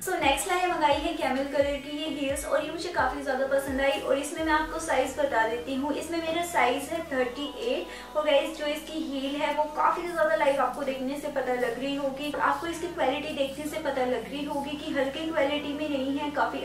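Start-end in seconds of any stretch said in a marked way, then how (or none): none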